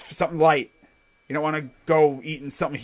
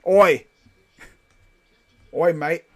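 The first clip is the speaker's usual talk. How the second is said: put-on Australian accent; 7 dB louder, slower than usual. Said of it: "the Australian".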